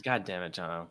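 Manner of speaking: mock exasperation. Host grumbles at cohost John with mock exasperation. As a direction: sigh it out in fake annoyance. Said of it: "host's mock exasperation at cohost".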